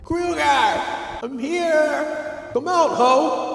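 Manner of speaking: shrill high-pitched retold yell; mock scream calling out for Kruger. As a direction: scream it out high like a girl hunting a killer.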